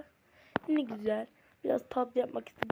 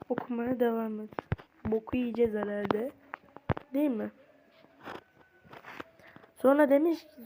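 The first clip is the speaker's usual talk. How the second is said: ordinary delivery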